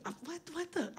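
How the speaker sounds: put-on hushed, baffled kid voice; confused little kid. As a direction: mutter it like a lost seven-year-old. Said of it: "I was like".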